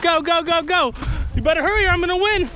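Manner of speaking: high, loud, fast teasing; playful racing cheer. Real, not acted. Racing the kid uphill.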